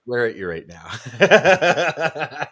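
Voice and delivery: slow, laughing mock threat; drawn-out laughing joke. Real, not acted. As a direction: tease through laughter. Joking jealousy, laughing.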